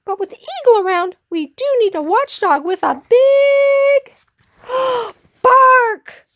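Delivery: high, quick, building to a loud finish; squeaky little hero. Acted. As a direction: swell up to a gasped, shouted last word. Pip builds up to bark.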